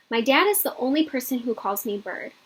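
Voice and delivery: usual speaking voice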